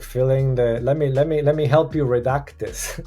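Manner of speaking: ordinary talk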